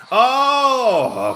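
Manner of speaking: long, high, loud "oh"; drawn-out exclamation, swooping up then falling away; startled outcry. Real, not acted. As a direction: cry out at a sudden mishap. Sudden reaction to the game.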